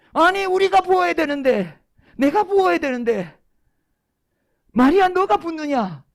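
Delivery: high, loud indignant protest from the disciples. Acted. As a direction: cry out in outraged complaint, pitched high. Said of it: "난리가 났어요". (발끈해서 높게 외치는 항의)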